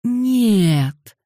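exasperated, moaned refusal; groaning. A narrator's reading. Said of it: "простонал Дэниел".

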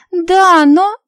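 put-on high, loud protesting girl; spoiled rich girl. A narrator's reading. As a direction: start to object, then falter. Лия protesting, cut off.

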